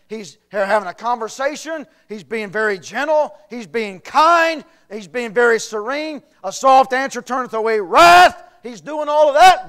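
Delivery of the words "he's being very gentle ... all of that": preaching. Real, not acted. Preaching, rising in force.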